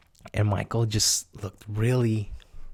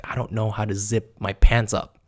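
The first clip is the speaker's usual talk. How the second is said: ordinary talk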